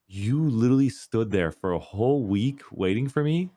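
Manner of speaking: high, slow, mocking disgust; mock disgusted gamer. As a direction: drag out you are sick at the creep. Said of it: "It's like".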